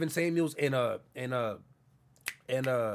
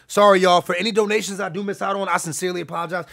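loud, raised-voice apology; 4 semitones higher, 10 dB louder than usual. Real, not acted. Streamer apologizing to viewers for missed donations, calling out loudly. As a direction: call the apology out over the stream.

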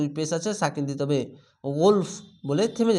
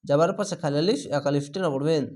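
ordinary talk

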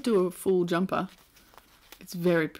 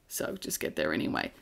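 breathy whispered aside, almost no voice; 9 dB quieter than usual. Real, not acted. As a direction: drop to a whisper for a sly tip. Aside after praising New Zealand.

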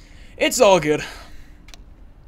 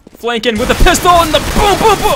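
excited shouting; 13 semitones higher, 10 dB louder than usual. Real, not acted. Charging in with a pistol.

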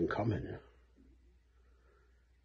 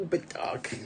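breathy whispered comment; whispered aside; 6 semitones higher, 7 dB quieter than usual. Real, not acted. Aside after a dark joke.

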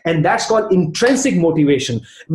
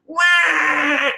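high imitated baby wail; shrill baby cry; 10 semitones higher than usual. Baby who lost sight of her mother, shrill cry. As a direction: mimic a crying baby's shrill wah. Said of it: "babies cry in a very shrill voice".

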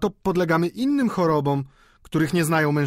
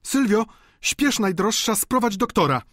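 high-pitched frantic order; urgent alarm; 12 semitones higher, 4 dB louder than usual. Acted. Widow sending Sylwia for a doctor, high and frantic. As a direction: send her off in a panicked high voice.

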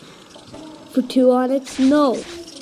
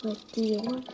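whispering; 2 semitones lower, 9 dB quieter than usual. Real, not acted. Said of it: "unvoiced whisper while filming".

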